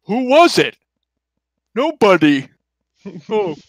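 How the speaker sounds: a slow-witted brute calling back; dopey cartoon bellow. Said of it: "a deep bellowing voice".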